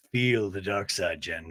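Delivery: low, slow, ominous coaxing; dark-side villain. Acted. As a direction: lure Jen in with a deep, slow voice. Low put-on villain line to Jen.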